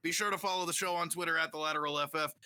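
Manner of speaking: usual speaking voice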